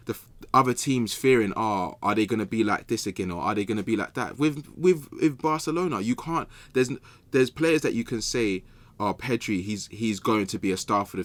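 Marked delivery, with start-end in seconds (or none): none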